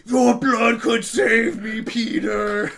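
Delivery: slow, loud, hammed-up villain shout. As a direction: bellow it like a cartoon villain. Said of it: "the really weird goblin guy is like".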